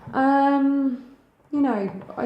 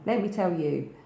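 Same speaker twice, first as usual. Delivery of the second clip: normal talking voice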